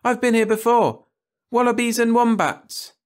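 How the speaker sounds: put-on high, loud know-it-all girl; showing-off child. Frances showing off.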